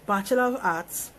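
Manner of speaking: ordinary delivery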